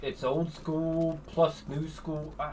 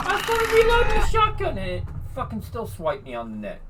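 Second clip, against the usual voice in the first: higher, louder exasperated rant; frustrated; 11 semitones higher, 4 dB louder than usual. Real, not acted. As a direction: vent it in a frustrated burst. Complaining about game death.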